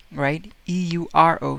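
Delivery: ordinary delivery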